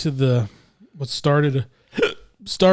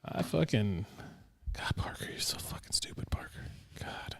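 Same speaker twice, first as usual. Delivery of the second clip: angry whisper; 5 semitones lower, 17 dB quieter than usual. Acted. Whispered curse at Parker.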